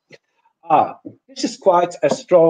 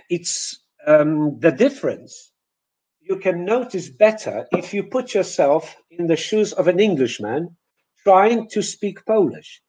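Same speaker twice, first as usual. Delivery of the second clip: ordinary delivery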